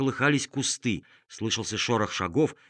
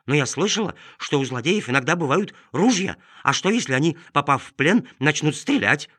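cartoon dog; 5 semitones higher, 5 dB louder than usual. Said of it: "пролаяла собака".

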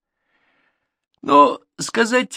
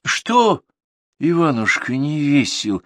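raised, gentle questioning horse voice; caring little horse; 7 semitones higher than usual. Acted. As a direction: comfort a sad friend. Horse comforts Ivan.

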